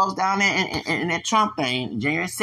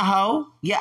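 usual speaking voice

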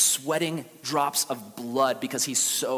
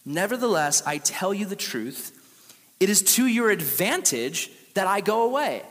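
scripture reading; 5 semitones higher, 4 dB louder than usual. Acted.

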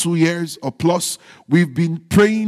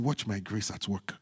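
much softer, hushed voice of God; voicing God; 11 semitones lower, 16 dB quieter than usual. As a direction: let God's line fall low and soft. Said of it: "God is saying".

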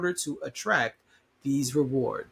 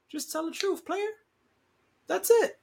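playful high call-out. Pretend. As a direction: toss it off lightly like calling out a buddy. I shouldn't even say player.

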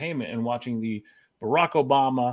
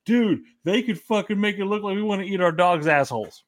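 higher and louder, driving disbelief; exasperated rant; 6 semitones higher, 4 dB louder than usual. Real, not acted. Ranting at his cohost about the dog example, raised and exasperated. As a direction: keep hammering how absurd the example was.

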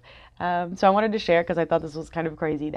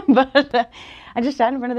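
laughing; 6 semitones higher, 7 dB louder than usual. Real, not acted. Still laughing after admitting vulnerability.

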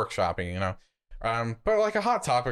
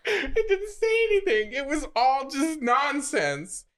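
amused squeal. Real, not acted.

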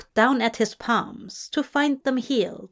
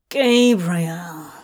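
low, louder growl of a name; 4 semitones lower, 4 dB louder than usual. A narrator's reading. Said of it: "Crawley growled".

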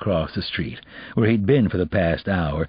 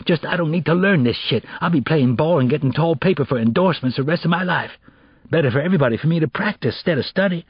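cocky, fast bragging street voice; boastful teen boy; 8 semitones higher, faster than usual. Acted. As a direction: brag about his future. Kevin boasting.

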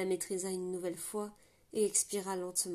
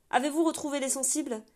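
raised, urgent question through the door; shouted question; 6 semitones higher, 7 dB louder than usual. Acted. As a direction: call out for news. Commandant crie à travers la porte. (le commandant crie sa question à travers la porte)

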